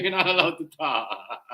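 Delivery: laughing through the words. Real, not acted. Streamer repeating the line to chat, laughing. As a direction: repeat it while cracking up.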